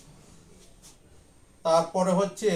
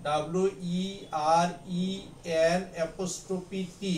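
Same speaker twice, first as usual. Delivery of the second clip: ordinary delivery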